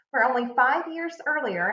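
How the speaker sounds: ordinary talk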